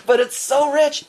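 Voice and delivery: laughs, then "so rich" high and bright with joy; laughing delight. Real, not acted. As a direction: let the laugh spill into the line.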